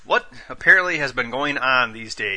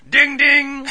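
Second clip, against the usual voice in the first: boxing bell imitation; 13 semitones higher, 5 dB louder than usual. Boxing bell for round two.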